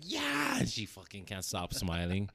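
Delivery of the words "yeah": put-on high squealing baby cry of joy; excited baby squeal. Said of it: "She's just like".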